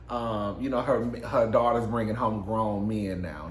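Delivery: normal talking voice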